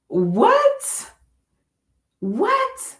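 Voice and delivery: high, startled what, what; high-pitched double what; surprised what. Retold or imitated. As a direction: sound startled and puzzled. Waking to orange frog vision.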